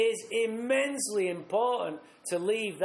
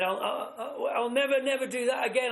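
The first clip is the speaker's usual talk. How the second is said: higher, stammering, imitating a teary plea; tearful pleading; 6 semitones higher than usual. Begging the boss for his job, teary stammering plea. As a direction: beg like you're close to tears. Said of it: "I'm crying".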